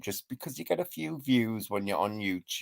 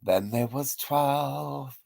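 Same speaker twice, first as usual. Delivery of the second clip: mock countdown; 5 semitones higher, 7 dB louder, much slower than usual.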